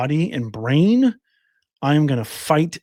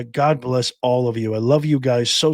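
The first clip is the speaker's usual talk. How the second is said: usual speaking voice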